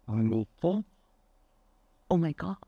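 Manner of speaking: ordinary talk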